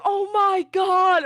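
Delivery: slow mock cry of alarm; mock exclamation. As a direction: imitate an overwrought rider. Overheard rider exclaiming on rides.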